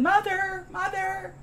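very high, thin mock fawn cry for its mother; crying baby deer. Imagined orphan skunk calling for its dead mother, high mock wail. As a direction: call out like a lost cartoon fawn. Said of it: "heard Bambi running through the woods yelling".

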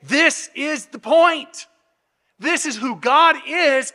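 slow, high, emphatic declaration; bolded emphasis. Shown as a demonstration. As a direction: spell it out word by word, as if in bold type. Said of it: "we would have bolded it".

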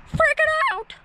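a sudden high squeal of excitement; excited shriek. Real, not acted. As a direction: yelp it out, losing it.

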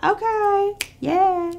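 high-pitched, tentative agreement; awkward going along. Retold or imitated. Friend humouring a rage-partying girl, high awkward agreement. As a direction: humour her in a small high voice.

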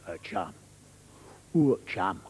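put-on low London accent; mock-menacing London tough; threatening Londoner. A Londoner threatens his chum, menacing London accent. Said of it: "The Londoner".

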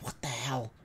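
breathy mock whisper of confusion; whispered disbelief. Gamer retelling the out-of-range surprise, whispering. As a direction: hiss it under your breath. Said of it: "I'm like".

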